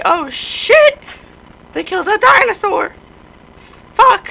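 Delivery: high, loud exclamation; shocked outcry. Pretend. Player finding a dead creature, high loud shock. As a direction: cry out high in alarm.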